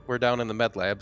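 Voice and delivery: ordinary talk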